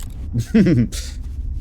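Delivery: short burst of laughter. Real, not acted. Laughs at own failed move.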